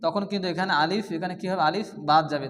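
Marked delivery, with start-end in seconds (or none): none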